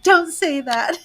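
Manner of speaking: high, whiny, half-laughing protest; mock-wounded girlfriend. Girlfriend defending her crush Sasha, mock-wounded and high. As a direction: pretend to be hurt, giggling through it.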